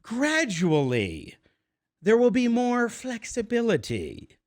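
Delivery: drawn-out, measured mock quote; Dr. Fauci quoted. Host quoting the health official to listeners, slow and drawn-out. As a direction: stretch it out slowly, imitating an official's vague promise. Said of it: "Doctor Fauci said".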